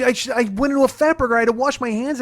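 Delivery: high-pitched aggrieved insistence; indignant protest. Retold or imitated. Husband defending his story to wife, indignant protest. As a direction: insist on the details, worked up.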